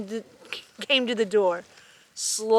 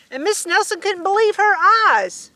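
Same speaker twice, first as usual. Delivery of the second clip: usual speaking voice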